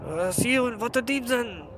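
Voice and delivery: high, loud, bitter guild rival; grudge-holding NPC. Acted. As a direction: spit out hatred for rival guild. Quest giver ranting about rivals.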